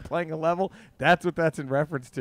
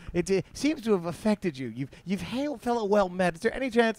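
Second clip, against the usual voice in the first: cute director; 5 semitones higher than usual. Retold or imitated. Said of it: "he's trying to be cute".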